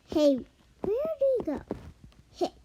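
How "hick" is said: hiccup. Acted. Character has hiccups.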